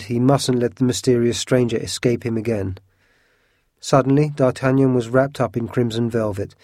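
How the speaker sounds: ordinary delivery, nothing marked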